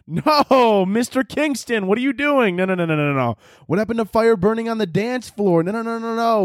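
ordinary delivery

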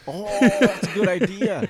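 laughing. Real, not acted. Laughing at hawk joke.

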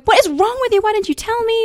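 high-pitched mock outrage; indignant friends. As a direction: imitate a friend's squealed reproach. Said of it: "calling me and going".

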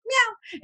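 high, short imitated meow; high kitty call; cat meow. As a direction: meow to find someone. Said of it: "I always meow".

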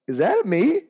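high, loud confrontation; challenging. Retold or imitated. Coach confronting a heckling adult, challenging. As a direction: call him out. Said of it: "and I was like".

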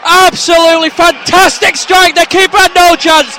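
loud, high, thrilled praise; excited shout. Real, not acted. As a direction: rave about the strike. Raving about the goal.

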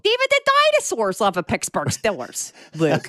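hammed-up Pittsburgh Yinzer accent, pitched high. Podcaster finishing co-host's line about Pittsburgh, in a mock Yinzer accent. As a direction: play a Pittsburgh Steelers fan in broad Yinzer. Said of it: "That's a solid Yinzer accent".

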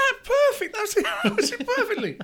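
high, loud words through laughter; real laughing. Real, not acted. Delighted at co-host's impression, laughing loudly. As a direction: crack up while praising it.

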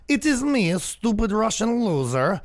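high, put-on Russian accent. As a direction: ham up a comic Russian accent, slowing at the end. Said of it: "What if I do a Russian accent?".

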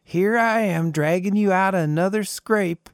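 wistful sigh toward the sky; old man musing to the stars, sighing. A narrator's reading.

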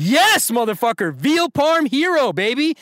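high, loud celebratory whoop; excited cheer. Real, not acted. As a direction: cheer the win like a sports fan. Host celebrates correct answer.

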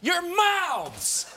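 high, loud yell at everyone; furious shout. Acted. Silencing the bickering coworkers, a furious high shout. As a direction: scream them into silence.

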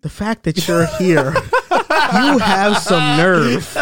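slow, drawn-out disbelief at the nerve; mock indignation. Retold manager confronting no-show worker.

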